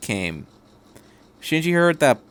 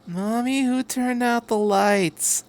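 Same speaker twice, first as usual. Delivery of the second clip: put-on high, slow little-child voice; a small kid in the dark asking for mommy; 10 semitones higher, 4 dB quieter, slower than usual. A narrator's reading. Toddler asks his mother in the dark train, high and slow. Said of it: "a child's voice asked".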